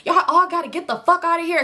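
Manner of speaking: high-pitched mock angry outburst; retold cussing out. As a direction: act out cussing everybody out of a room. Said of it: "they was cussing".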